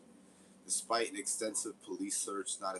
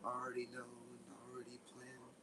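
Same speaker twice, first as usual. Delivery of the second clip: softer, mocking sing-song imitation of Toby; 5 semitones higher, 7 dB quieter than usual. Mocking Toby's FBI threat in a joke song, soft sing-song. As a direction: lightly parody his threat as a lazy song. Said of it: "we're just gonna sit there and be like".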